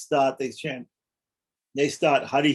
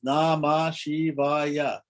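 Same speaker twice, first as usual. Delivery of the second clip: Namah Shivaya drawn out very slowly, each syllable separated; much slower than usual. Shown as a demonstration. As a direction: count out Na-ma-Shi-va-ya by stretching every syllable. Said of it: "five syllables".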